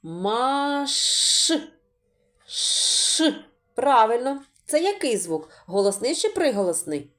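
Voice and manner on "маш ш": маш then a hissed Ш, higher; teacher isolating Ш; sounding out Ш. Shown as a demonstration. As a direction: hiss the Ш on its own. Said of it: "слухайте".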